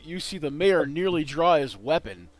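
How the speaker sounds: ordinary talk, nothing marked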